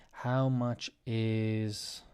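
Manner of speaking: very slow, drawn-out words; slowly modelled phrase; slow teaching model. Shown as a demonstration. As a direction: stretch each word out for learners. Repeats the model phrase slowly for learners.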